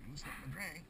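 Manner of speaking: ordinary delivery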